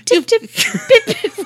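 high, slow laughing English chirp; English accent. Pretend. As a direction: stretch out a giggly English pip pip. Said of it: "jolly old England accent".

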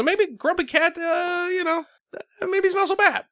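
squeaky high hopeful voice; high silly voice. Acted. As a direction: say it in a tiny high voice. Voice shift, high and odd.

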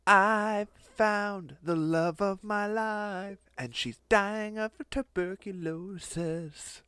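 hammed-up slow lovesick wail; Carl's lament. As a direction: moan it like a tragic lover, slowly. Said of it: "He's like".

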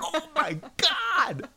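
high, drawn-out mock wail through laughter; mortified exclamation. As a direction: re-enact mortified horror, loud and laughing.

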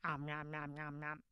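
low, soft om-nom eating noise; munch through it on one low note; mock munching. Praying dieter pausing to eat cupcakes, mock munching.

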